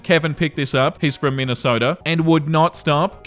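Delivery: normal talking voice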